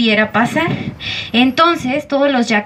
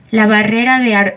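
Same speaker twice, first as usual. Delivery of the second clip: ordinary delivery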